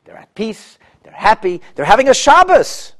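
high, rising, gloating voice of the scheming king. Retold or imitated. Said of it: "He said".

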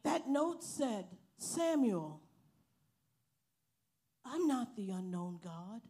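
impassioned preaching. Real, not acted. Preaching climax reading God's note.

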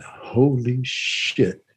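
drawn-out, very slow amazed exclamation; retold amazement. Man recalling his shock at being hypnotized, slowly amazed. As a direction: stretch the words out in stunned disbelief.

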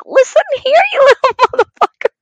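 very high, loud play-scolding through laughter; mock furious warning. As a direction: re-enact a threat while cracking up. Her side of retold quarrel.

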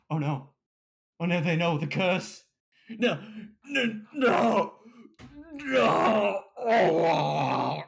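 mock panic, crying out no; hammed-up terrified cries. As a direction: ham up the horror of transforming. Cursed transformation acted out.

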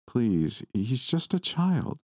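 high, slow pleading grandmother; sad plea. Acted. Grandmother begging the boy, high slow plea. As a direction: plead slowly, voice lifting high. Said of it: "My grandmother sounded sad".

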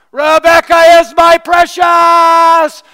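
high, slow, all-out shout; yelling out the car window. Retold or imitated. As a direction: bellow it as loud as you can, stretched out. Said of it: "I would yell as loud as I could yell".

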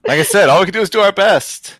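high, loud, amused protest; laughing self-defence. Real, not acted. Host teased about a botched accent, laughing self-defence.

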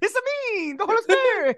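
put-on high-pitched Italian accent; cartoonish Italian plumber's squeal; Mario. Comic voicing of the Holy Spirit, Italian accent. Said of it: "if the Holy Spirit's voice is".

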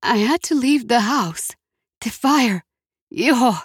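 lightly acted breathless distress; huffing. A narrator's reading. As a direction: huff it out over the phone. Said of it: "she huffed".

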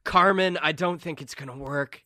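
doubting henchman. Acted. Limbo member doubts Carmen caught the greeter, exasperated. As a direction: voice a doubtful, complaining crony. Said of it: "and they're like".

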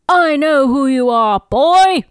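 furious shout. Acted. Widmore roars at Ben in fury. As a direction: bellow boy at him.

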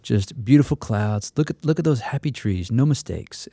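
hint of Bob Ross gently coaxing a nervous student; Bob Ross impression. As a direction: soothe like a TV painting host. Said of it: "like Bob Ross paints".

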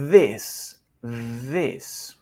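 "This" slowly, buzzed th and short lax i; pronunciation drill. Shown as a demonstration. Teacher asks learners to repeat, careful pronunciation model. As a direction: model "This" alone, tongue between teeth, short vowel. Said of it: "repeat with me".

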